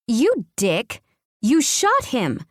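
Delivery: high, angry yell; furious scream. A narrator's reading. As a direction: scream in rage at the shooter. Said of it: "I scream at the driver".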